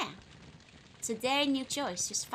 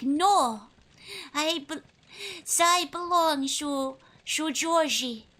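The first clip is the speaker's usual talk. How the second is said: put-on French accent; 4 semitones higher, 6 dB louder, slower than usual. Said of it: "I cannot do a French accent".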